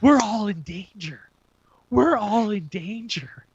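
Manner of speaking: imitated ominous warning, slowing down; mock dread, drawn out; fearful warning. Friends pulling the guy away, fearful warning. Friends warn of Mister Conspiracy.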